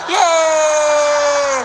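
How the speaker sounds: put-on high excited kid's yay; mock childish cheer. Kid cheering in retold scene.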